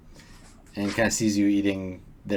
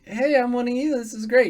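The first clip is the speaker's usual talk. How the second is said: high-pitched fake friendliness; overdone "one of the gang" cheer; mock chummy; 12 semitones higher, 3 dB louder than usual. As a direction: imitate an eager buddy, pitched way up. Mocked ingratiating line, played for contrast.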